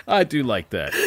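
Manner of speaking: usual speaking voice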